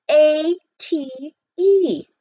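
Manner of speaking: slow, high, loud letter-by-letter; teasing spelling. A narrator's reading. The auctioneer teasing Jack, slow mock spelling.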